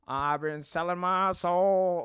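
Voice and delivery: slow, drawn-out Southern song line; Southern accent. Shown as a demonstration. Guest recalling a song for the host, slow Southern accent. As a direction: stretch the lyric in a Southern accent. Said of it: "I'll just do it in the accent".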